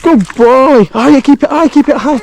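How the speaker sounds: high, urgent encouragement; excited coaching. Real, not acted. Coaching son netting fish.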